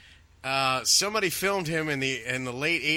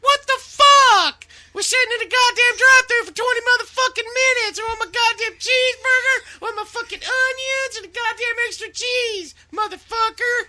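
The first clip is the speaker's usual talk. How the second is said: much higher, much louder enraged screech; imitating a screaming fit; 10 dB louder than usual. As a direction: scream it all like a man losing his mind. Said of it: "Cornette had a fucking fit".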